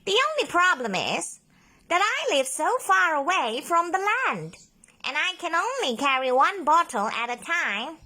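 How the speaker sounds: high, worried octopus. A narrator's reading. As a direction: explain the snag. Ollie explains a problem.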